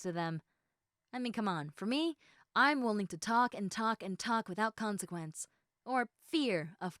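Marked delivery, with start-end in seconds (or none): none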